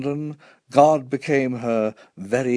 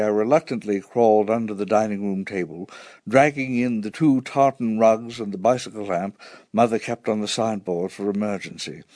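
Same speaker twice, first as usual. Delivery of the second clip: plain reading voice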